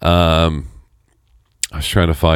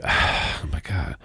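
soft, breathed-out dismay with a sigh; hushed retold exasperation; imitating exasperated mom; 10 dB quieter, much slower than usual. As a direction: sigh it like a weary mother.

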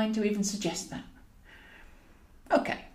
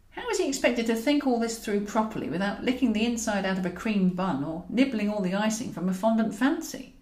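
usual speaking voice

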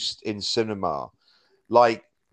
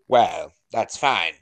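lightly voiced, slow, unbothered shrug; a hint of Vincent Price not caring at all; unfazed villain; 3 dB louder, slower than usual. Mimicking the villain hearing of the plot, slow and unfazed. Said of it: "unremotely fazed by learning".

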